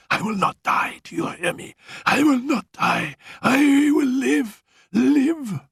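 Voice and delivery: high, desperate insistence; gasped defiance. Retold or imitated. Dying Annette fighting death, high desperate gasps. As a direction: gasp it out, refusing death. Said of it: "she gasped out".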